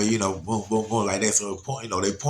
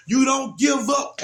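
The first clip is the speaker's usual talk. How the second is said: slow, high retold shout; urgent encouragement; 9 semitones higher, 3 dB louder, slower than usual. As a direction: call it out firmly across water. Shouting encouragement to struggling daughter.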